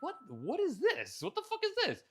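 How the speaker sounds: imitating his own baffled panic; rising disbelief. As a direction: let the pitch run away as it sinks in. Self-quote, access denied surprise.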